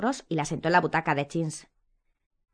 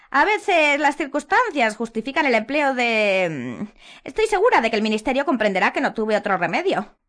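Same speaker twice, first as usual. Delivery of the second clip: Umbridge voice; 6 semitones higher, 5 dB louder than usual. A narrator's reading. Umbridge voiced in read story.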